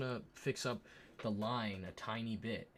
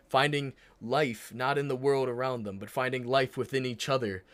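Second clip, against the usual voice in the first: mock poetic; 7 dB louder than usual. Real, not acted. Mock-poetic description of couple.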